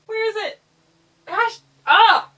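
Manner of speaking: high, loud, rattled; flustered bug hunt; real jumpy squeals; startled. Real, not acted.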